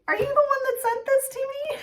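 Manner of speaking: put-on asking her girlfriend in surprise, high and excited; sudden realisation. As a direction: squeal it in surprise. Realising friend sent gift.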